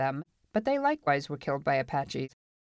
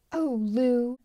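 put-on higher, exasperated girl's voice; impatient; 8 semitones higher than usual. A narrator's reading. Marla chides Lou, impatient. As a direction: scold him with a drawn-out appeal. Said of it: "she was impatient".

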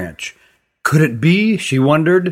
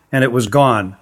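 ordinary delivery, nothing marked

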